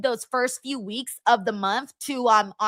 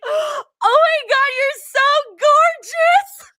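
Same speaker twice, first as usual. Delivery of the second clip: much higher, slow, breathless squeal after a gasp; fangirl squeal; 8 dB louder, much slower than usual. Retold or imitated. Teen fan meeting her wrestling crush, squealing high and slow. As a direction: swoon out the words like a starstruck teen. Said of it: "I fucking said that to Wade Barrett".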